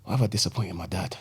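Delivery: very soft, low inner voice; son's regretful thought. Retold or imitated. Son looking at dad while told off, quiet regret. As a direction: imitate a chastened boy thinking to himself.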